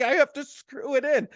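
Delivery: dad yelling at his son holding sheetrock; exasperated yelling. Retold or imitated. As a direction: snap instructions at a clumsy helper. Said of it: "just yelling at my idiot teenagers".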